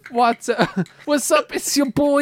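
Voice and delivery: high, bright YouTuber intro voice; Watso impression. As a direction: hype it like a YouTube host opening. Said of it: "that is not what Watso sounds like".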